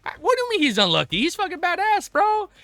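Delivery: high-pitched, heated fan defence; indignant excitement. Real, not acted. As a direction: protest in a high, incredulous voice. Excitedly disputing that Anderson is unlucky.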